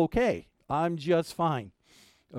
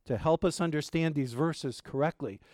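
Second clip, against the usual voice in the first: usual speaking voice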